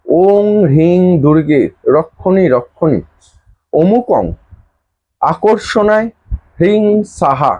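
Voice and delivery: slow, measured recitation of "ওম হ্রিং দুর্গে রক্ষণী"; mantra pronunciation demo. Shown as a demonstration. Teacher shows followers how to pronounce the mantra, slow deliberate recitation. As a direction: draw out each word from "ওম" to "সাহা", pausing between phrases. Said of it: "উচ্চারণটা আমি বলে দিচ্ছি".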